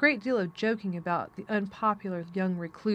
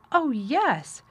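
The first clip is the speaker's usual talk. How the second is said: higher, louder delighted exclamation; excited cry; 6 semitones higher, 5 dB louder than usual. A narrator's reading. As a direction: cry out, flushed with pleasure. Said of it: "cried Colin, quite flushing".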